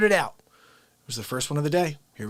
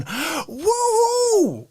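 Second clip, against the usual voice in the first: a very high whoop from a fan in the crowd; 4 dB louder than usual. Retold or imitated. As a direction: cheer it out of the stands. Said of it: "somebody in the crowd's going".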